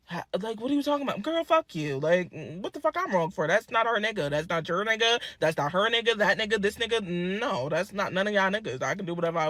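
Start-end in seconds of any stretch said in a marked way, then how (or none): none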